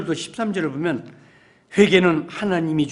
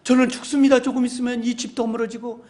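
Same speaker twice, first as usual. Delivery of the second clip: desperate loud prayer; 5 semitones higher, 4 dB louder than usual. Pretend. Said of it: "소리내서 기도했어요".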